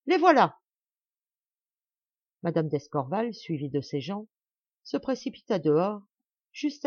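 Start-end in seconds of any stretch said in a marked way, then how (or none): 0.1-0.6 loud high shout; excited shout. Acted. Gardener shouting the carriage has come, loud. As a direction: call out that they've arrived. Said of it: "s'écria le jardinier".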